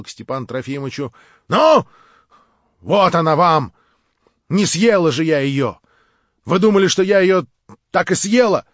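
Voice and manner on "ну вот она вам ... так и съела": громко, с укором; брось упрёк; сердитый вызов. Acted. Варвара с вызовом. (сердитый укор)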